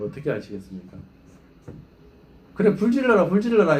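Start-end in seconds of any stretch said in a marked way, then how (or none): 2.5-3.8 raised, louder pretend taunt; mock defiant dare. Pastor mockingly dares the elder to burn the church, raised and louder.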